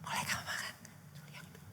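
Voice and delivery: put-on hushed warning to child. Parent whispering a warning to a small child. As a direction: voice drops to a whisper by the end. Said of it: "Saudara ngomong".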